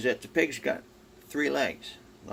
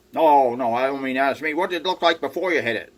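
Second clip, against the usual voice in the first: much louder, higher, exasperated; loud correction; 6 semitones higher, 11 dB louder than usual. Retold or imitated. Gruff farmer correcting the driver who hit the cat, loud and exasperated. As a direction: set him straight loudly.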